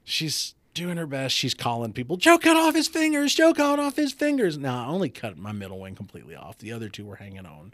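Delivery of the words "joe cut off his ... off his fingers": high, loud hysterical announcing; shrill-pitched imitation of a frantic caller; frantic wife. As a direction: wail the news to everyone in mock panic. Imitating frantic wife phoning people.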